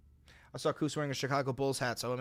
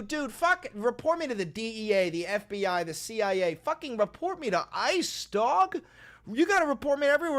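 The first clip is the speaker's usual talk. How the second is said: mock defiant yell; 6 semitones higher than usual. Streamer daring a donor to report him, hammed-up yelling. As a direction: dare them to report you, shouting.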